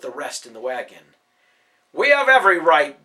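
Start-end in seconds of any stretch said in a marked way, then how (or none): 1.9-3.0 loud, higher, domineering yell; Brutus yelling back. A narrator's reading. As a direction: shout down the parents. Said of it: "Brutus yelled".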